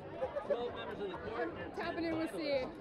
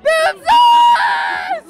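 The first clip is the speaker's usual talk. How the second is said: higher, much louder yell; enthusiastic shout; 11 semitones higher, 13 dB louder than usual. Shown as a demonstration. Fan shouts the catchphrase on the host's cue, full force. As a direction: belt it out as hard as possible. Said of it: "say "boom sauce" in the most enthusiastic way possible".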